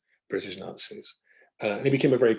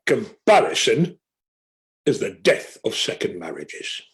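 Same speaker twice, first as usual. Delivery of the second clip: put-on Ray Hill's northern accent; 5 dB louder, slower than usual. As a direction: deliver a loud verdict in a northern accent, sinking at the end. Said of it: "in his very northern accent".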